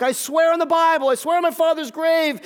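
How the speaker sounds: loud, high-pitched swearing; vehement oath. Acted. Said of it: "invoking curses on himself".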